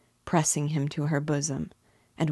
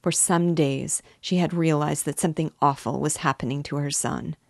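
ordinary delivery

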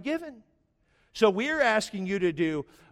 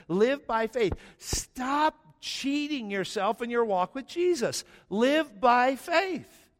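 high, slow, ringing preacher's call; rising sermon climax, much higher; preaching at full force; 8 semitones higher, much slower than usual. Acted. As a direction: drive the point home from the pulpit.

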